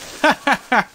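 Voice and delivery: high-pitched burst of laughter; gleeful laugh. Real, not acted. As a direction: cackle at a lucky escape. Laughs at the creeper.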